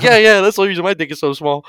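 high, loud and fast; hasty agreement. Acted. Another prisoner seizes the same excuse, hurried and high. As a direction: jump on the excuse quickly.

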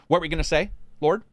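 normal talking voice

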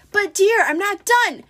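high, loud pleading falsetto; wheedling pretend bride, pitched high. A narrator's reading. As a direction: protest in a high fake-bride voice. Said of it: "in his falsetto voice".

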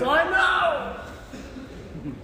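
high, stretched-out mock wail; exasperated agreement. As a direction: wail it in comic despair. Worker agrees in misery, acted.

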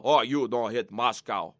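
low, quiet put-on Putin, disbelieving; mock Putin. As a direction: scoff low and soft. Putin voiced replying.

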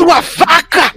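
high-pitched mock furious cry; mimicked insult. Re-enacting Lid turning on her rival, high mock outrage. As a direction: spit out a theatrical insult. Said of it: "virou pra você assim".

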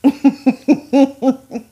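a real amused laugh; bursting into laughter. Real, not acted. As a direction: laugh at your own joke. Laughs at own joke.

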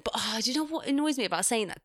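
ordinary talk, nothing marked

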